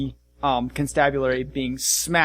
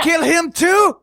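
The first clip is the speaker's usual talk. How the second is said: loud, high dwarf accusing; angry dwarf captain; 8 dB louder than usual. Acted. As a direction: snap at them like the dwarf.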